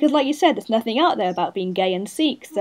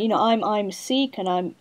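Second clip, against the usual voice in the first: ordinary talk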